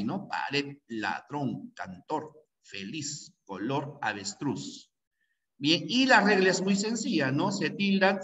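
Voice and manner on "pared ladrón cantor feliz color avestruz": Pared, ladrón, cantor, feliz, color, avestruz softly repeated, each stressed on its final syllable; softer word list, weight on the end of Pared, ladrón, cantor, feliz, color, avestruz; stress on last syllable. Shown as a demonstration. Teacher repeating examples for students, softly stressing the last syllable of Pared, ladrón, cantor, feliz, color, avestruz. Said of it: "Fíjate la fuerza de voz ahí".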